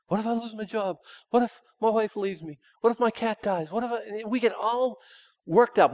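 high, fretful what-ifs; lightly voiced worried believer; mock worrier. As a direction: play the anxious listener. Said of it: "listing worries in worrier voice".

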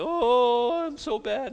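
high, drawn-out mock moan; self-pitying wail. Condemned believer wallowing in guilt, high mock wail.

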